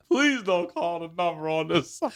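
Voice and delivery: pleading while laughing; laughing plea. Real, not acted. Narrator laughs while begging them not to call. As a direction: laugh through the request.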